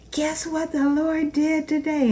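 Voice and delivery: high, bright-eyed question about the Lord; excited question. Retold or imitated. Daughter and mother sharing the Lord's daily gift, excited. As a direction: tell it like good news bursting out. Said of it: "we would say".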